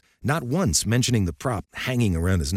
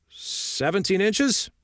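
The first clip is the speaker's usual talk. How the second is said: slow, high, unsure answer; hesitant guess; 12 semitones higher, much slower than usual. Acted. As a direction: offer it as a reluctant guess. Another reluctant coach guesses.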